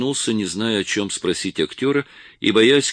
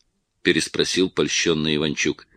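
plain reading voice

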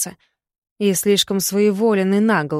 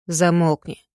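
curt interruption. A narrator's reading. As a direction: cut him off flatly. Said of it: "перебил его словесный поток Помпей".

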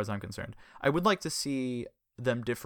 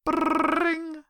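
high, loud ringing phone noise; imitated phone ring; 6 dB louder than usual.

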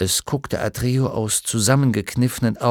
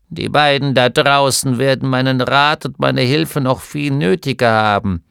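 louder, self-important little gnome man; a lightly voiced stubborn old scholar; grumpy gnome; 6 dB louder than usual. A narrator's reading. (Gnom widerspricht seiner Frau, lauter und eigensinnig)

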